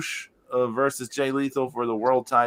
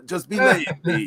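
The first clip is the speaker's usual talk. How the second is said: hammed-up Italian accent, a bit higher; mock Italian accent; 5 semitones higher than usual. Wrestlers urging each other, in mock Italian accent. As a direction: put on a cartoon Italian accent. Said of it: "making them sound like they're Italian".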